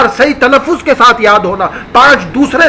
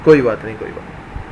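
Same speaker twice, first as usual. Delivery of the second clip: much softer, lower, trailing to a whisper; meek apology; 10 semitones lower, 9 dB quieter, faster than usual. Acted. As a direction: back down gently, nearly whispering. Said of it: "پھر وہ بولے گا". (دھیمی، جھکی ہوئی معذرت)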